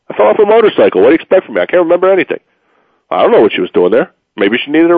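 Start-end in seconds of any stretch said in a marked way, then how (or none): 0.1-2.4 faking amnesia. Pretend. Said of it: "And you claim amnesia".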